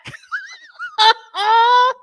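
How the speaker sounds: high loud cackle; squealing laugh; shrieking laugh. Real, not acted. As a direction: howl with laughter. Big laugh.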